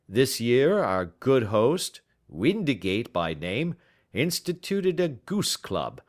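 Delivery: lightly voiced pompous old scholar; Baker voice. Baker explaining the goose club to Holmes, lightly pompous.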